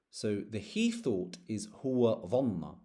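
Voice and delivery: ordinary delivery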